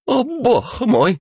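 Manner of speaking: put-on high, slow, startled old woman cry; frightened exclamation. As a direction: gasp it out in fright. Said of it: "испуганно воскликнула она". (испуганный возглас пожилой женщины)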